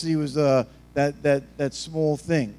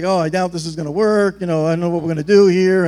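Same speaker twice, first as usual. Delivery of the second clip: mock doubting Thomas; 7 dB louder than usual. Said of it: "Thomas is like".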